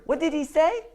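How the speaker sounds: ordinary talk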